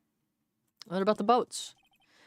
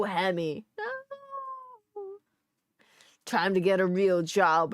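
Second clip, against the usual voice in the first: ordinary delivery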